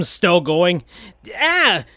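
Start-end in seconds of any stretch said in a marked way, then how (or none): 1.4-1.8 startled yelp, pitched high; mock startled shout; high cartoon yelp. A narrator's reading. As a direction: cry out in alarm. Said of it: "shouted Sonic".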